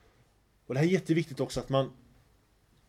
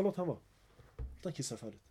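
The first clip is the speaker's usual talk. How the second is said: much softer, gentle calm instruction; soft, patient repeat of the calming words; 13 dB quieter than usual. Retold or imitated. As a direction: hush them tenderly, fading away. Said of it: "Han lärde honom fint".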